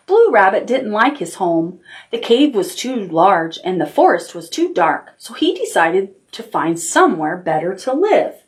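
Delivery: gentle storybook reading; reading to children. Acted. Teacher reading a picture book to the class, storytelling. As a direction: tell it like bedtime story time.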